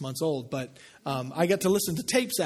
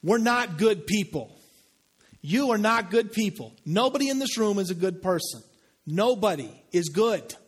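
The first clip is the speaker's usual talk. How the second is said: preaching hard; 4 semitones higher than usual. Real, not acted. Pastor confronting the whole room with their sin, preaching hard. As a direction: drive every word into the congregation. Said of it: "I'm gonna just preach hard".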